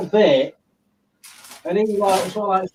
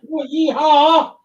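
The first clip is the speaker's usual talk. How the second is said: cowboy yeehaw; 7 semitones higher, 5 dB louder, slower than usual. Pretend. Cheering along with the woohoo.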